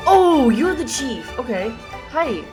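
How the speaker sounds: ordinary talk, nothing marked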